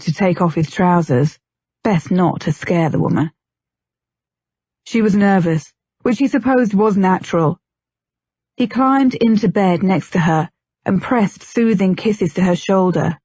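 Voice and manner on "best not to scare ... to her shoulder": plain reading voice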